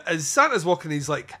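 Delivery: normal talking voice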